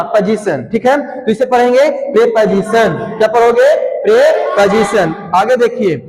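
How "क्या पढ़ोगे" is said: normal talking voice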